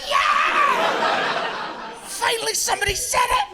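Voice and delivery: high-pitched imitation of a delighted crowd; mock jubilant cheer. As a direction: squeal it like a cheering Polish audience. Polish audience cheering the joke.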